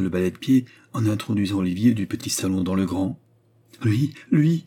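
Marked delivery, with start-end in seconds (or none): 3.8-4.6 André thrilled at the count's arrival; excited cry. A narrator's reading. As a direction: cry out the name with delight. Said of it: "s'écria André".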